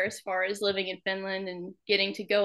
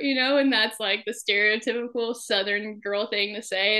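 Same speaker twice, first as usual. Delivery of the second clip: normal talking voice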